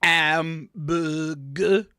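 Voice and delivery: put-on very slow, drawn-out French accent; put-on French pronunciation; mock French accent. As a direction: stretch every syllable in a hammy French accent. Clouseau-like joke guess, drawn out.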